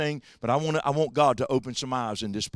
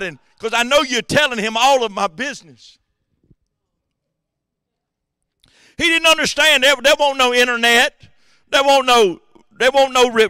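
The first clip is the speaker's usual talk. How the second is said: high, loud Syrian king demanding answers; angry king; 10 semitones higher, 9 dB louder than usual. Acted. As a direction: rail at the servants like a suspicious king. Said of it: "he cried out".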